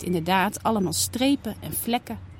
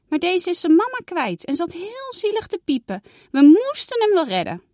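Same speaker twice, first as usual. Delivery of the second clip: put-on higher, louder begging little girl; a child begging to keep the kitten; pleading girl; 9 semitones higher, 4 dB louder than usual. Saar pleading with mama, higher girl voice.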